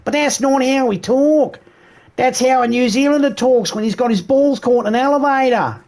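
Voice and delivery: high, squeezed mock Aussie accent; bad Australian accent. Shown as a demonstration. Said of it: "will normally sound like this".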